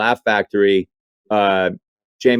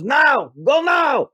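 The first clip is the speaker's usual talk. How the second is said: high shouted command; yelling order; much slower than usual. Retold or imitated. Guy yelling at the driver to drive, high shout. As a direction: bark it at her to go now.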